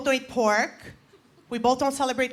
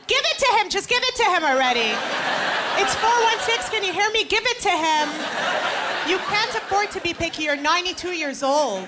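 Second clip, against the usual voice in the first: screaming mother; 5 semitones higher than usual. Retold or imitated. Mother screaming through the phone at her daughter. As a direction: shout over the phone to push her daughter. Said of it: "my own mother screaming into the phone".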